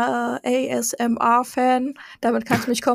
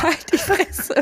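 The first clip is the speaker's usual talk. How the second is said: laughing; 7 semitones higher, slower than usual. Real, not acted. Laughing outburst at teasing.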